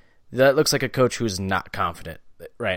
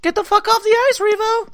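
high-pitched squeal, a bit louder; squeaky high voice; 6 dB louder than usual. Acted. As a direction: squeak it out like a cartoon. Mocking a shouted insult.

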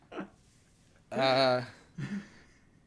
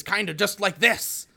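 Chris Farley impression; 7 semitones higher, 7 dB louder than usual. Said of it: "does he even have a".

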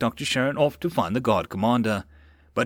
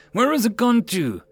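higher, louder panicked search; frantic cry; 11 semitones higher, 5 dB louder than usual. A narrator's reading. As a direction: call out in a frenzy. Frantic search for missing vial.

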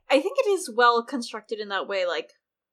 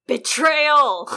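hammed-up angry cry, much higher and louder; angrier; 6 semitones higher, 11 dB louder than usual. Podcaster redoes the word angrier for her co-host, hammed-up fury. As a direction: throw real fury into the one word. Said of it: "we should be more angry".

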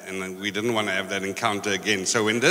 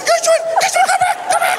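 very high, loud imitation of panicked pleading; Sabello pleading; 8 dB louder than usual. Storyteller mimics a friend begging over the car radio, high-pitched pleading. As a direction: squeal a hammed-up plea, far above your own voice. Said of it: "pleading with Gershwin".

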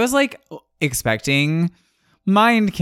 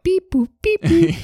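put-on high beeps and boops, like an old computer; robot beeping noises; 4 dB louder than usual. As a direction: chirp it like a retro sci-fi machine. Imitating eighties computer noises.

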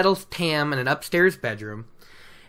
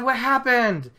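ordinary delivery

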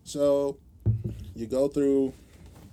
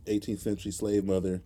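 usual speaking voice

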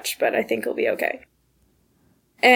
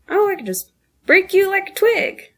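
teasing acquaintance; 7 semitones higher than usual. Retold or imitated. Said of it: "I've been told my whole life".

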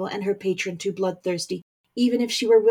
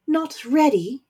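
higher, louder incredulous echo; rising voice; 7 semitones higher, 4 dB louder, slower than usual. Acted. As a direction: throw the words back, indignant. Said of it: "voice began to rise".

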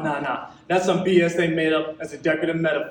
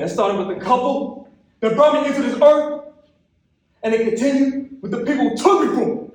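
impassioned blame; 6 semitones higher, 3 dB louder than usual. Acted. Poet blames those who failed him.

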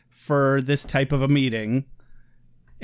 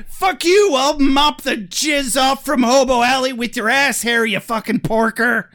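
shrieking high furious outburst; furious yell; 8 semitones higher, 4 dB louder than usual. Retold or imitated. Detective screaming at the lawyer in fury. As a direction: scream it at him, high and loud. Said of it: "then she's like".

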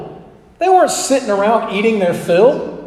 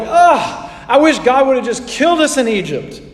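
whining, higher melodramatic lament; dramatic complaining; 4 semitones higher than usual. Retold or imitated. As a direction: overplay the Israelites' self-pity. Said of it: "we go overboard".

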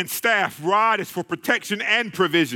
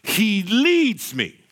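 slow emphatic; much slower than usual. Acted. Preacher savoring scripture.

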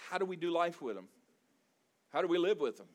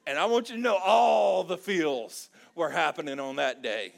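loud, booming preacher's cry; full-force shout from the pulpit; preaching shout; 3 semitones higher, 10 dB louder than usual. Real, not acted. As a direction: belt it out to the congregation. Preacher builds about emotional family.

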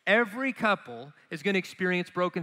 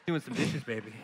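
smooth husband voice; 8 semitones lower, 5 dB quieter than usual. Retold or imitated. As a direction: purr it at your wife over the sink. Husband teasing wife while washing.

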